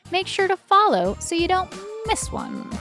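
normal talking voice

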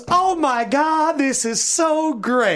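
high, slow gushing delight; imitating an overjoyed mother; thrilled mother. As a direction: coo it like a thrilled fan.

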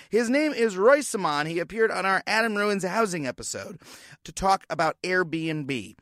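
ordinary talk, nothing marked

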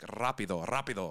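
Rapido with a rolled Spanish r. Shown as a demonstration. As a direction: roll the r in Rapido. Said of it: "you go like".

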